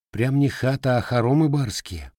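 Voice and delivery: teasing. A narrator's reading. Ulyana teases Matvei about his house, needling. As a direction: rib him about grand plans. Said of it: "поддела его Ульяна".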